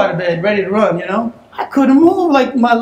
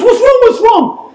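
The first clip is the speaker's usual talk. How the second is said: worried mom; 10 semitones higher than usual. Retold or imitated. Mom rushing in.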